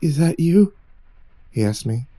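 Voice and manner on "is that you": a hint of an eerie, mechanical mimic; lightly voiced uncanny copy of a frightened boy asking; faintly robotic, off-kilter question. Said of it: "higher pitched and almost, almost mechanical".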